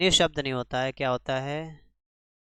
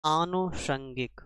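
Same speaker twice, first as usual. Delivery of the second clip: slow; much slower than usual. Shown as a demonstration. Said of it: "तो यहाँ पर आपका होता है".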